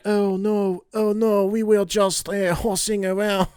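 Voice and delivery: mock-innocent French president laughing it off; flustered Macron. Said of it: "He was like".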